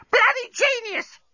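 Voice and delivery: very high, slow bird screech; parrot voice. A narrator's reading. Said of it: "said the parrot".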